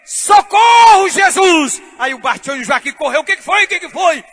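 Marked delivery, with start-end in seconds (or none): 0.0-1.8 high, loud, slow drawn-out cry; loud cry for help. Retold or imitated. Drowning boy crying out to Jesus, loud slow cry. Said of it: "ele levantou uma voz e disse". 3.2-4.2 alarmed question. Retold or imitated. Friends run up alarmed.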